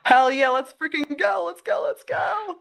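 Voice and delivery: high excited hype, rising to a squeal; excited cheering. Real, not acted. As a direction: cheer them on, all fired up. Cheering on a trading duel.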